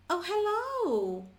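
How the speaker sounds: loud, high, drawn-out hello; acted phone call. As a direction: call down the phone, stretching every word high. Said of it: "eu pego o telefone e ligo pra você".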